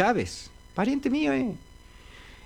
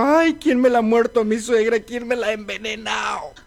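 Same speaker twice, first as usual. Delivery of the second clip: mock crying; 4 semitones higher than usual. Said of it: "Y lloraba este chango".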